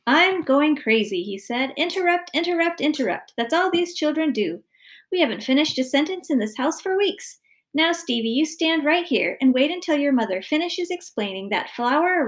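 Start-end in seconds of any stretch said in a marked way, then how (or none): 0.1-1.2 slow, loud, higher-pitched despair; exasperated. A narrator's reading. Father at his wits' end with children, exasperated. 1.8-12.2 higher-pitched fed-up father; exasperated rant. A narrator's reading. Father ranting about children interrupting, exasperated.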